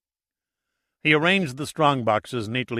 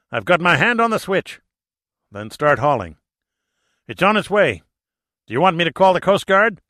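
high, loud, fast urgent calls; 6 semitones higher, 6 dB louder than usual. Acted. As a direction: hurry it along, high and loud. McGinty urgently hauling the bell.

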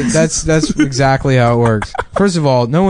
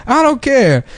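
Steve Harvey impression; 12 semitones higher than usual. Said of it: "You know, he's like".

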